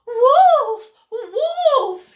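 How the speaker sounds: very high, very loud alarm shout, breaking into a laugh; crying wolf. Acted. Cat screams a fake wolf alarm at the birds, very high and loud. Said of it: "he cried".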